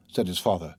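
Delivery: ordinary delivery, nothing marked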